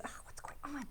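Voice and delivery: a breathy whisper of doubt. Pretend. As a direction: whisper it like a tiny inner nudge.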